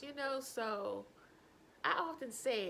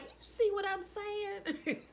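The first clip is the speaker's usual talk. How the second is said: vindicated; 9 semitones higher, 5 dB louder, slower than usual. Retold or imitated. Catching mom laughing.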